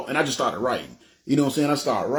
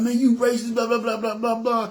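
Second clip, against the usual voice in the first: high-pitched, rattled-off angry rant; mock cursing out; 9 semitones higher than usual. Customer cursing at the store clerk, mock angry. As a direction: imitate a ranting tirade, much higher. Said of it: "So I just started cursing".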